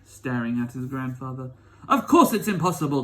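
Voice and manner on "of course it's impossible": put-on louder, emphatic old man; mild old grandpa cry. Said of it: "cried Grandpa Joe".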